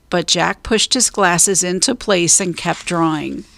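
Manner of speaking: ordinary delivery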